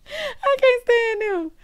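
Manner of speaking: very high, drawn-out squeal; high, slow, helpless laugh-voice. Real, not acted. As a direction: squeeze the words out after laughing hard. Speaking out of laughing fit.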